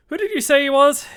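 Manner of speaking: high, loud sailor's question; loud demand. A narrator's reading. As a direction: snap the question at the boy. Silver demands the name.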